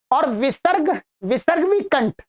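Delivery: ordinary delivery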